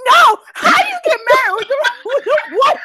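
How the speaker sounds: real laughing outrage. Real, not acted. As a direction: sputter through laughter. Laughing outrage about ashy elbows.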